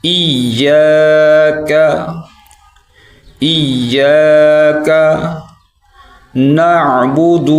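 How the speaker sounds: ایاک with doubled ya held long; slow model reading; stress on the shadda. Shown as a demonstration. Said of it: "اس کو ہم ایسے پڑھیں گے".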